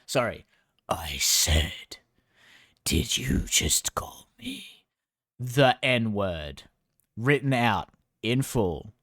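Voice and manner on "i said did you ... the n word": mock menacing whisper, slow and breathy; slow hammed-up whispered accusation; hushed whisper. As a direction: whisper it like a threat, drawn out. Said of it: "unvoiced whisper".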